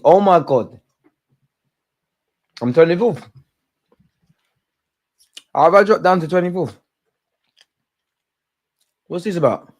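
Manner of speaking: high, loud and stunned; outraged surprise at the news; shocked disbelief. Real, not acted. Sees his fantasy league drop.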